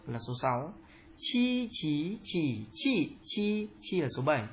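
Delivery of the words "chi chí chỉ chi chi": "Chi, chí, chỉ, chi, chi" through the tones, high level first; high, rising, dipping, falling takes of "Chi, chí, chỉ, chi, chi"; tone drill. Shown as a demonstration. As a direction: recite "Chi, chí, chỉ, chi, chi" changing only the pitch contour. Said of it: "phần luyện tập về thanh điệu".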